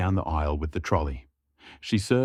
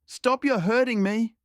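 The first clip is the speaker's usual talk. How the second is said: much higher scream of pain; 3 dB louder than usual. A narrator's reading. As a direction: scream as the arm is twisted. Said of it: "Zoe screamed".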